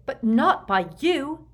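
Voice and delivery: high, loud, slow accusation; furious. A narrator's reading. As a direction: hurl the blame back furiously. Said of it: "said Bellatrix furiously".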